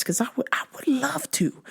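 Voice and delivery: breathy hushed wish; whispered longing; whispered aside. Real, not acted. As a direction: drop to a secret whisper. Sudden whisper.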